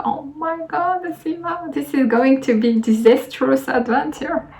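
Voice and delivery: high, hushed play-acted dread; mock dismay. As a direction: act out a small, high-pitched inner panic. Acting out her past dismay.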